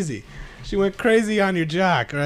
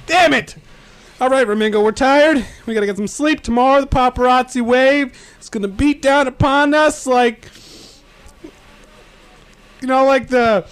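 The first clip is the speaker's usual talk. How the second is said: loud, raised-pitch exasperation; frustrated outburst; 9 semitones higher, 9 dB louder than usual. Acted. Weary companion groping for a metaphor, loud and frustrated. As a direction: belt it out, dropping the fancy accent. Loses accent, gropes for a metaphor.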